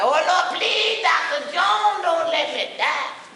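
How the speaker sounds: high, slow pleading wail; hammed-up plea to the doctor; begging patient. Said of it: "we beg in the doctor".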